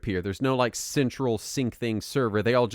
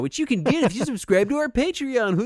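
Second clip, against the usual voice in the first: salesman voice; 10 semitones higher, 3 dB louder than usual. Acted. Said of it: "I don't know what that voice is".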